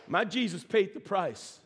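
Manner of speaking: ordinary talk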